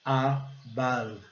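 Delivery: "Above" split in two, a and BUV; back-of-throat vowels. Shown as a demonstration. Teacher compares the two vowels, syllable-by-syllable pronunciation. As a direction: separate both uh sounds in "Above". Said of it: "também no fundo de garganta".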